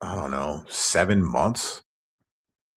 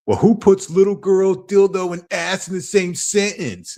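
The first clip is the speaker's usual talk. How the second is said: much higher, louder, accusing mock voice; mocking imitation of Kevin; 12 semitones higher, 3 dB louder than usual. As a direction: lampoon him with a high, prissy accusation. Mocking quote of harasser.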